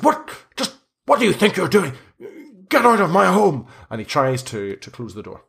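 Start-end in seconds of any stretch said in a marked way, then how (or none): 0.0-3.7 high, sharp scolding; indignant outrage. Acted. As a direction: order intruders out of his home. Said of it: "points his finger in your face and he says".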